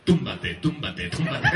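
drawn-out low "Túmbate, túmbate, túmbate" like a lazy heartbeat; slow sleepy túmbate. Pretend. Andalusian heart beating lazily, slow low chant. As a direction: lull it out, slow and heavy. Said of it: "el corazón no nos hace pum".

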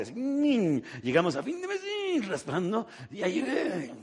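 high, squeezed voice like barely scraping by; hammed-up high-pitched squeeze; squeaky mock strain. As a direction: squeak it out as if at the very edge. Acting out scraping to month end.

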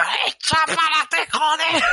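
high furious yell, laughing through it; shouting at Alexa. Retold or imitated. Man yelling at his smart speaker, full shout. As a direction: bellow the command at the speaker. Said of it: "Gritando que la gente me debe oír de la calle".

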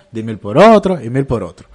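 ordinary talk, nothing marked

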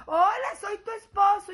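husband's loud greeting. Pretend. Pretend husband greeting his forgetful wife, loud and high. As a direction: call it out brightly like an eager husband.

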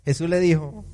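normal talking voice

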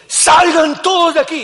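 high, loud, slow shout. Retold or imitated. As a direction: order them out at full voice. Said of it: "Imagínense".

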